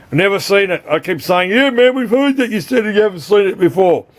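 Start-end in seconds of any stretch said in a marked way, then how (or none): 1.5-4.1 mock viewer voice. Mocking viewers' reply.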